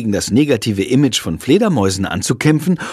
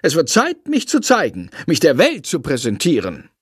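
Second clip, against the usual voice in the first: a lightly voiced proud bat, higher; grand bat voice; 9 semitones higher than usual. A narrator's reading. Bat proclaims to the children, lightly pompous higher voice. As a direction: announce yourself like a vain showman.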